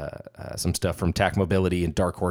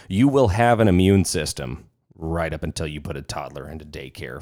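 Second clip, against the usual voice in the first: ordinary delivery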